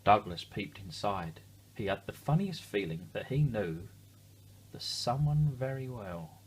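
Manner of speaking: ordinary delivery, nothing marked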